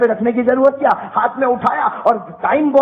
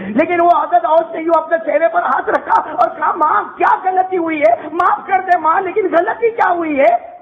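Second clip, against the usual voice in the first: pleading son; 7 semitones higher than usual. Retold or imitated. Son pleading after the slap.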